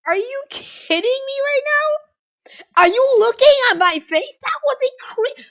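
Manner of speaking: high cracking disbelief; disbelieving shout. Real, not acted. Disbelief after comeback.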